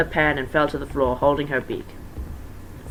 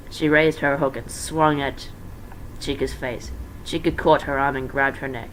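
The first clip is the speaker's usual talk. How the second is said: ordinary delivery, nothing marked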